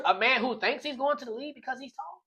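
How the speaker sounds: ordinary talk, nothing marked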